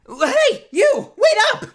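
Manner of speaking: high, loud call from afar. Acted. Larry calls after Phoenix across the bridge, high loud yell. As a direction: yell to stop them walking off.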